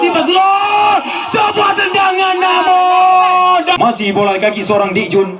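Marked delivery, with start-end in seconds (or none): 0.0-3.6 high, slow rising excited call; rising excitement at the shot; excited commentary. Real, not acted. Attack builds, commentator rises.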